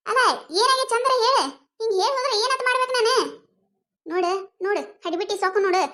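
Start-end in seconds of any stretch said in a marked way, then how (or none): 0.1-3.2 higher, exasperated protest; exasperated tailor. Acted. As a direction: throw up your hands, pitch rising.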